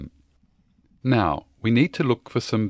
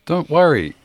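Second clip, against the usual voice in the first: higher, louder quick reassurance; brushing it off; 6 semitones higher, 6 dB louder than usual. Acted. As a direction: wave off the worry. Reassuring rushed parent.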